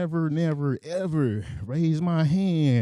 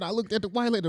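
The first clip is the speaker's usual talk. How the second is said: ordinary talk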